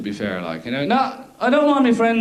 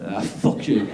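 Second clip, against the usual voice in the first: low dismissive cat; 11 semitones lower, 3 dB quieter, slower than usual. Retold or imitated.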